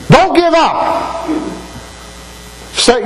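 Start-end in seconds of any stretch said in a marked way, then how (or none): 0.1-1.3 drawn out slowly and pitched high, every word stretched; fervent plea. Real, not acted. Preacher begging the congregation not to quit, slow fervent plea. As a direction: plead with them not to quit. Preacher exhorting runners of faith.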